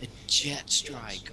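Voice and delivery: high-pitched American gamer whine, stretched at the end; mock American accent. Gamer complaining about a lost kill, mock American accent. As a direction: put on a hammed-up American accent, pitched well up. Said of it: "I'm gonna put the Southern accent on".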